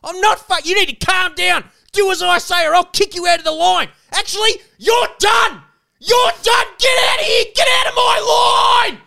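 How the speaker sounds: high, loud yelling that keeps building; shrieked orders at full volume; freaked-out screaming. Retold or imitated. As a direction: imitate a power-mad guard losing it. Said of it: "She freaked out".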